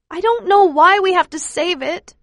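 high, loud tearful whine; a worn-out child protesting; child's tearful complaint; whining child. Acted. Tired child protests to father.

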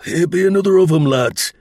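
loud, fast Lancashire soldier, pitched high; a Lancashire Tommy calling his mates; rough Lancashire shout to the lads; Lancashire accent. Acted. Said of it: "the voice was the voice of Lancashire".

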